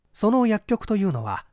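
ordinary delivery

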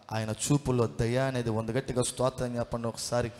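usual speaking voice

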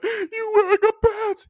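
very high, slow sobbing squeal; mock crying. Crying boy answers the teacher's question, mock crying. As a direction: whimper the answer like a bawling kid. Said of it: "whoever's in front of us crying".

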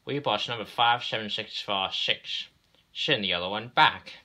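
insist, building to a loud final order; insistent railway boss. Acted. Railway boss instructs the inspector, insistent.

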